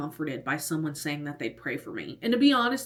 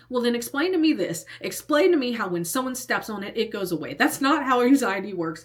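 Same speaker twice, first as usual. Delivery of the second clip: higher, louder challenge, retold with force; full-on arguing; 5 semitones higher, 4 dB louder than usual. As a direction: demand an explanation, replaying the argument. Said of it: "I'm full on arguing with this man".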